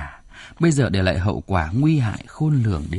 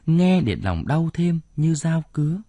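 plain reading voice

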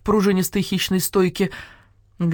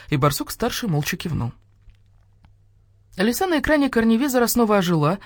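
ordinary delivery

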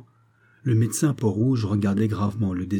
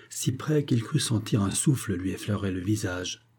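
usual speaking voice

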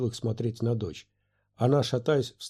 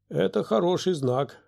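higher, mildly hopeful lift; encouraged; 7 semitones higher than usual. A narrator's reading. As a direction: brighten up a little at the good sign. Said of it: "воодушевился Диди".

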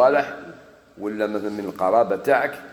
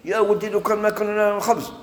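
mock panic; 9 semitones higher than usual. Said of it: "بصح ماشي".

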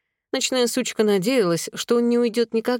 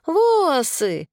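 put-on much higher toddler protest; high childish outrage; indignant little boy; 9 semitones higher, 3 dB louder than usual. A narrator's reading. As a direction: complain louder in baby talk, pitched way up. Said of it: "возмутился он".

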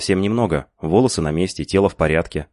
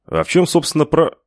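cut-off buddy; 11 semitones higher, 4 dB louder than usual. A narrator's reading. Лукаса обрывают на полуслове.

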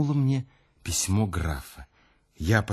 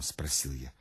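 ordinary delivery

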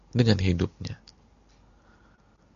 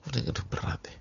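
hushed sigh; 4 semitones higher, 6 dB quieter, faster than usual. Acted. Sermon hushed aside.

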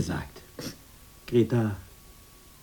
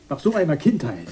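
ordinary talk

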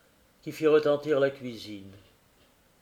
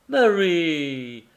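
exclaiming; 3 semitones higher, 4 dB louder than usual. A narrator's reading. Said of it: "s'écria le voiturier".

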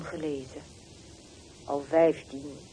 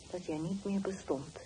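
usual speaking voice

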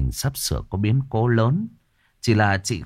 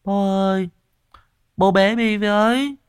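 a little son whining to be carried; whining little boy; 13 semitones higher than usual. A narrator's reading. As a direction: beg like a spoiled small child. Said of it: "thì làm nũng".

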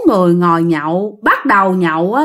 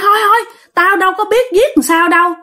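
agitated refusal; 8 semitones higher, faster than usual. Retold or imitated. Dad refusing the cat killing.

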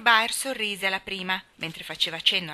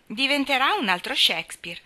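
higher, louder amused quip; laughing remark; 6 semitones higher, 5 dB louder than usual. Acted. Aunt Jo laughing at Demi's poem, amused teasing. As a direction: tease through laughter. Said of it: "disse la zia Jo ridendo".

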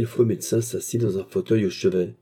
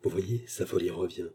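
hushed aside; 2 semitones lower, 7 dB quieter than usual. A narrator's reading. Doctor aside to the maid, hushed. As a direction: say it under your voice. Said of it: "dit tout bas".

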